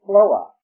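floa with no R, drawn out in two beats; southern Georgia pronunciation. Shown as a demonstration. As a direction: say floa, dropping the R. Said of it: "how they pronounce the word floor".

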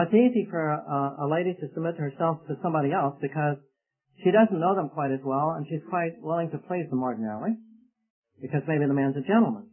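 ordinary talk

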